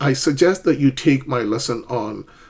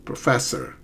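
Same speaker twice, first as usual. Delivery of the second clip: softer "professor", stress on FES; stress on second syllable; 5 dB quieter than usual. Shown as a demonstration. Teacher modelling the correct word, "professor" stressed on second syllable. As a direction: lean on the middle syllable of "professor". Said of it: "it's the second syllable that's stressed".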